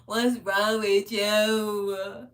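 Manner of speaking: slow, drawn-out disbelief at mama; retold scolding of mama, slow and loud; exasperated plea. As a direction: stretch every word in dismay. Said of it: "I'm like".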